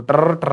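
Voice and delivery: टर, टर with a long rolled r; frog-like trill. Shown as a demonstration. Teacher warning students not to croak, rolled r. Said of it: "Don't become मेंढक".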